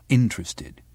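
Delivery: ordinary delivery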